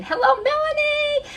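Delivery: very high, singsong hello; loud, high call of delight; excited greeting. Real, not acted. Greets a known broadcaster joining.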